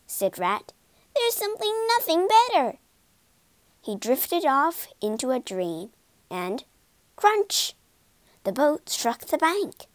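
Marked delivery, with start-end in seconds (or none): 1.1-2.8 enthusiastic. A narrator's reading. Rat enthuses about boats. 7.2-7.7 high, sudden crunch; sound-effect word. A narrator's reading. Narrator acts out the crash.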